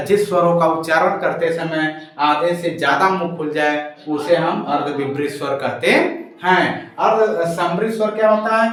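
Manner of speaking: ordinary talk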